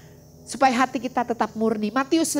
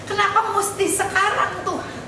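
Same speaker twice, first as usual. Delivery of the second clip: laughing complaint; 9 semitones higher than usual. Retold or imitated. Retold complaint prayer with laugh.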